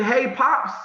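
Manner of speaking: high, loud call of surprise; calling out to dad. Retold or imitated. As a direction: call out to your father, stunned. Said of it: "And I said".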